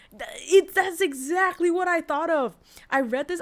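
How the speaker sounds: high, loud burst of agreement; excited. Real, not acted. Streamer to chat, excitedly agreeing about the bed, high and loud. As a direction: blurt it out, thrilled you thought the same.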